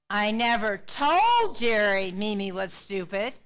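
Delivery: slow sentence, punch told; stress on told. Shown as a demonstration. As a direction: make told jump out in pitch and volume. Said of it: "putting the emphasis on a different word".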